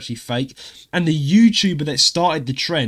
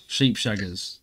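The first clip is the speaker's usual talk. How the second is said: muttered joke; 5 semitones lower, 5 dB quieter, slower than usual. Retold or imitated. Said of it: "That's what we call them".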